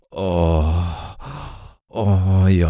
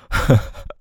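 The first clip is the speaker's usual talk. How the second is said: breathy laugh; 5 semitones higher than usual. Acted. Laughs at partner's teasing.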